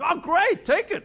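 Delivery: bright-pitched, hammed-up enthusiasm; acted cheerful agreement. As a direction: play a delighted owner giving permission. Acts the owner happily agreeing.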